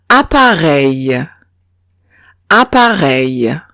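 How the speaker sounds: appareil said slowly, ending in eil; slow model of appareil; French appareil. Shown as a demonstration. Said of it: "Otro ejemplo es".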